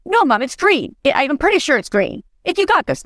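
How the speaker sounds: lightly voiced son reassuring mom; imitating son. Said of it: "He's like".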